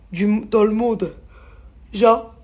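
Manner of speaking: slow, heavy outrage at being misjudged; indignant. Real, not acted. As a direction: lean on every word, fed up. Indignant closing rant on kindness.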